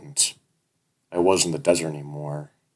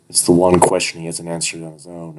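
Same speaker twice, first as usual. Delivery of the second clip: plain reading voice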